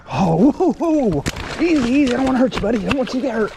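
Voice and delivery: high and loud, easing the fish; calm him down while he thrashes; urgent soothing. Real, not acted. Angler pleading with the thrashing fish to keep still, high urgent calls.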